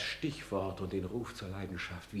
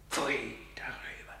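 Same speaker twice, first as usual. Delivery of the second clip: much softer, whispered contempt; whispered disgust; 13 dB quieter than usual. Acted. Hamlet disgusted with himself. (angewidert geflüstert)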